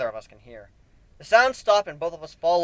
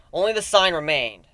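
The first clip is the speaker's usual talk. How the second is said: ordinary delivery, nothing marked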